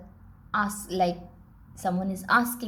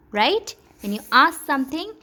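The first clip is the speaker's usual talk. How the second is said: higher, louder and quick; emphatic; 6 semitones higher, 8 dB louder than usual. Real, not acted. Teacher stressing the rule to the child, loud and high. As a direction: press the point home.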